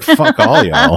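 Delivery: high, squeaky brush-off; dismissive mock voice. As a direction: toss it off in a silly high voice. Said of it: "you're just like".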